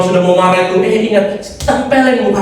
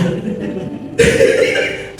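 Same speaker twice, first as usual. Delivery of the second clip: a quiet, airy chuckle; a small embarrassed laugh at his own quick temper; soft breathy laugh; 5 dB quieter than usual. Real, not acted. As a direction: laugh under the breath at himself.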